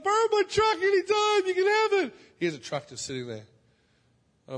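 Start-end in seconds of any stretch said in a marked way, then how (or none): 0.0-2.1 high, loud, gushing offer; overjoyed neighbour. Retold or imitated. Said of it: "He's like".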